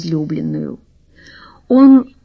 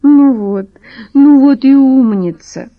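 put-on high, loud chirpy girl voice; chirping; 6 semitones higher, 7 dB louder than usual. A narrator's reading. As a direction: chirp it like a pleased princess. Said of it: "прощебетала".

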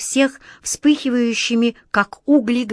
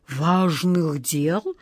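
very slow, quieter, lower trembling question; 3 semitones lower, 3 dB quieter, much slower than usual. A narrator's reading. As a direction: echo it in dread. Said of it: "дрожащим голосом повторил Джин". (дрожащим голосом)

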